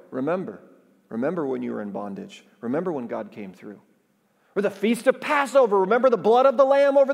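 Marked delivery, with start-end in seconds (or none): 0.1-3.8 dropped low and soft, almost to himself; hushed remembering. Real, not acted. Preacher hushing the congregation to remember. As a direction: let it fall quiet and reverent.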